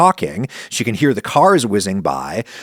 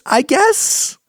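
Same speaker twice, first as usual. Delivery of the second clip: unsure. Real, not acted. Joking doubt about plant ears.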